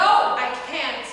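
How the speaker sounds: strained shout. Acted. Struggling under attack.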